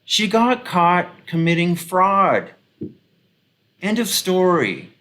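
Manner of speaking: high, slow, loud and final; emphatic retort. Retold or imitated. Commentator retelling their answer to the radio defenders, emphatic. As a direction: lay it down word by word, case closed. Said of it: "And I'm like".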